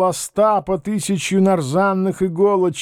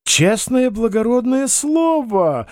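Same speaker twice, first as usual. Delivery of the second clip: high, drawn-out indignant oath; exclaiming protest; 7 semitones higher, slower than usual. A narrator's reading. Panikovsky protests to Bender, exclaiming. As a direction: swear innocence in a high, slow wail. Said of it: "воскликнул нарушитель конвенции".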